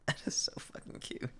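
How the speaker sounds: hushed, overwhelmed by cuteness; whisper. Real, not acted. Repeated gushing over cute drawing.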